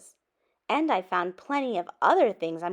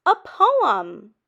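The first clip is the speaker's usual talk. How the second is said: excited discovery; 3 semitones higher, 9 dB louder than usual. A narrator's reading. Proud discovery.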